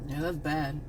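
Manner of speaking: mocking, soft and low, can't-be-bothered reply; indifferent mumble. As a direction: toss it off like you don't care. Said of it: "Everybody was".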